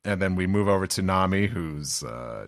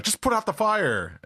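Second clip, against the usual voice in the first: high-pitched called-out order; mock shout; 13 semitones higher than usual. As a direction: call it out over the flames. Someone shouting in burning village.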